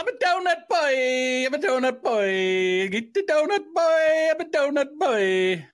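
squeaky slow parody chant; mock silly voice. Cohost parodying the clip in a high, slow, silly voice. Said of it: "I thought it would sound more like".

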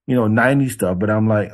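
usual speaking voice

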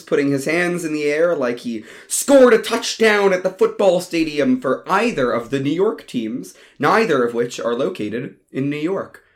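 ordinary delivery

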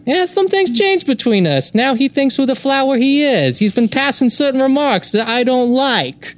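put-on high, tough-guy Boston accent; Boston Joe Pesci. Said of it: "I'm gonna be a Boston Joe Pesci".

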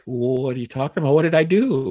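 high, bewildered self in retelling; confused protest. Retold or imitated. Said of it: "I'm like".

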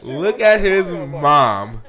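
slow, loud, laughing through the words; amused disbelief. Real, not acted. Host watching clip, slow loud laughing disbelief. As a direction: drag it out in giggling disbelief.

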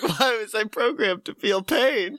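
milk the robot's line as the punchline; hammed-up robot lament. Retelling the robot's line, laughing.

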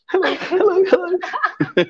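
high, giggly put-on greeting to the mirror; mock flirty hello. Viewers preening at the mirror for Lou, high giggly mock hello. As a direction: coo it like a smitten fan testing a smile. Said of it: "people currently looking in the mirror going".

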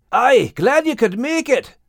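high, loud, gladdened character voice; grinning. Acted. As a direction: greet a friend through a wide grin. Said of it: "said Max with a wide grin".

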